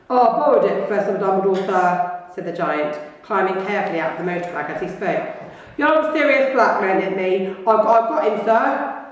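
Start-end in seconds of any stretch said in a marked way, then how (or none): 0.1-2.2 a louder, rougher giant's voice, apologetic; Hagrid, big and gentle. Acted. Hagrid explaining himself to Dumbledore in a big voice. 5.8-9.0 a louder, rougher giant's voice, apologetic; Hagrid, big and gentle. Acted. Hagrid explaining himself to Dumbledore in a big voice.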